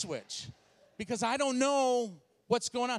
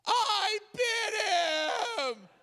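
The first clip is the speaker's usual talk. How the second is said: high, loud sobbing confession; hammed-up crying shout; tearful wail; 13 semitones higher, 4 dB louder, much slower than usual. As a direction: blurt it out bawling like a distraught wife. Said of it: "she's got tears coming down her face".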